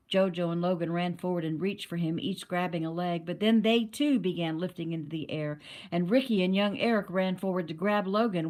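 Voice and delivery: ordinary delivery, nothing marked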